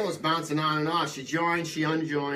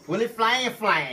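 slow, higher drawling character voice; goofy drawn-out introduction, pitched up; Willie Flan; 4 semitones higher, slower than usual. Acted. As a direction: stretch out the name in a put-on twang. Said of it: "I'm gonna be Willie Flan voice".

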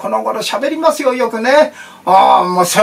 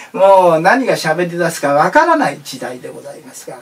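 normal talking voice

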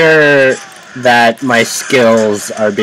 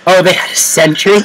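normal talking voice

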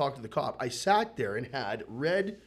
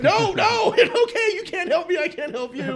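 loud, high, frantic backing away; hammed-up alarm, easing slightly by the end; mock panicked refusal; 11 dB louder than usual. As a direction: act out a flustered panic, half laughing. Retold panicked refusal of help.

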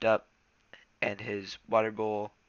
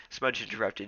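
ordinary delivery, nothing marked

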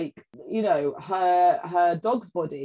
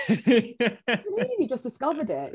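higher, drawn-out mock disbelief; baffled ancestors; 8 semitones higher, slower than usual. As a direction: play incredulous ancestors, slowly. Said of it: "our ancestors are like".

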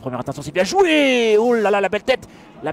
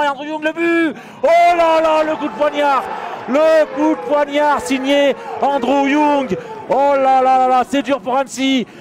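excited goal call; 4 semitones higher, 5 dB louder than usual. Real, not acted. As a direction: roar the late winner. Late winning goal call.

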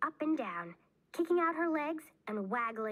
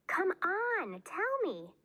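high-pitched, insistent wheedle; insistent pleading; 10 semitones higher, slower than usual. A narrator's reading. Bean keeps pressing red-faced Ivy to tell, high and insistent. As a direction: beg for the secret, pitched way up.

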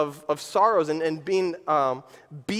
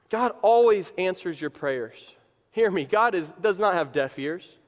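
usual speaking voice

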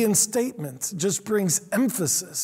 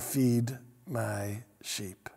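solemn; 8 semitones lower, 8 dB quieter, much slower than usual. Acted. Jesus speaks, slow and low.

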